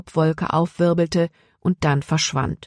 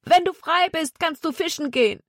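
high, far-carrying call; shouting after someone; 12 semitones higher than usual. A narrator's reading. Jonathan follows the fleeing Zack, shouting after him. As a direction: call it after a runner in a raised pitch. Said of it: "schrie Zack immer noch hinterher".

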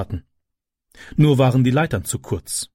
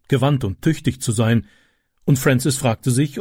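ordinary talk, nothing marked

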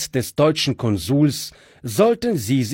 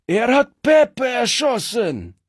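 bandit screaming; 10 semitones higher than usual. Acted. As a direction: scream the news of the shot comrade. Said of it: "schrie einer der Strolche".

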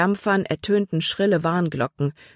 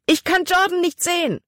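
much higher, desperate; losing him in the dark water; frightened cry; 13 semitones higher than usual. A narrator's reading. Libby loses sight of drowning boy. (verzweifelter Ruf, viel höher)

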